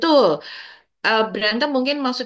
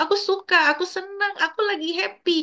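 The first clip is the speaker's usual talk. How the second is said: much higher, bright sing-song delight; cheerful happy demo; 9 semitones higher than usual. Shown as a demonstration. Parent modelling happy words for child, high cheerful lilt. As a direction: show a child how happy sounds. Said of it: "plus ekspresi dan intonasi".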